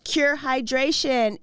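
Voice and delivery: slow announcer-style product name; ad tagline. Acted. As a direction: say the brand like a commercial. Ad read slogan.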